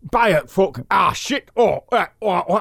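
too northern. Pretend. Comedian overshoots into northern while fixing his voice. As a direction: blurt it out as a broad northern yell. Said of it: "I just went a bit too northern".

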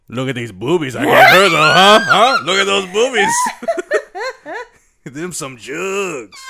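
loud, proud Italian sculptor shout; Italian accent. Acted. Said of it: "That's my Italian accent".

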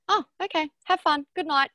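high, lightly put-on little girl voice; four-year-old daughter. Daughter sending mum off and going to bed, imitated child voice. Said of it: "She went".

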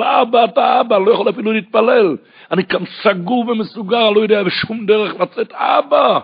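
loud, high pleading shout; desperate cry. Acted. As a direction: cry out like someone trapped with no way out. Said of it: "את הצעקה הזאת". (זעקה נואשת בקול גבוה ורם)

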